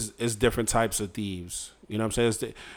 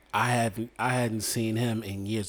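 normal talking voice

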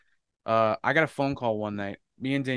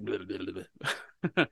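babbling blah-blah; 3 semitones lower than usual. Shown as a demonstration. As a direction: tumble out blahs under the voice. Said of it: "That's why I'm just like".

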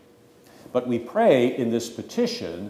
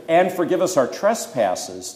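"And" pitched high and loud, leading the petition; lifted, emphatic "And" opening the phrase; stress on AND; 6 semitones higher, 5 dB louder than usual. Shown as a demonstration. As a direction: lean on the linking "And" before "forgive us". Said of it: "and" there links you to the fourth petition".

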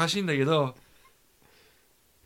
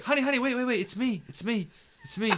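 high, hurried "wait, wait"; frantic pleading; 10 semitones higher than usual. Retold or imitated. Husband pleading with his wife on the phone, frantic and high. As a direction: catch her before she hangs up. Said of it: "I'm like".

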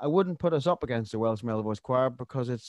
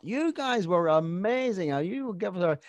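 gushing Welsh fan; 8 semitones higher than usual. Retold or imitated. Quoting Welsh fans who praised the choir, in a high gushing voice. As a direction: mimic a fan laying it on thick. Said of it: "I heard this a lot in Tenby".

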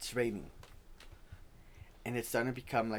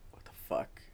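hushed startled whisper; whispered what the fuck; 9 dB quieter than usual. Retold or imitated. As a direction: breathe it out in shock. Whispered reaction in scary story.

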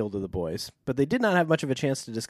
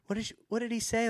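fast, soft, anxious question; quick worried ask; hurried aunt; 9 semitones higher, 7 dB quieter, faster than usual. Retold or imitated. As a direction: rush it out worried.

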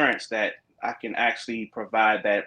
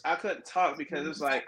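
ordinary delivery

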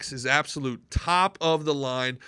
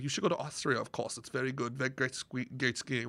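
low, soft Austrian impersonation; understated Austrian accent, lower and much softer; 6 semitones lower, 12 dB quieter than usual. Retold or imitated. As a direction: imitate a matter-of-fact Austrian woman, quietly. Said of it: "she hasn't lost her accent".

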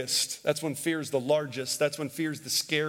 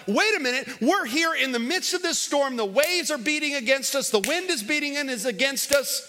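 mock panic; 7 semitones higher than usual. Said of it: "being like".

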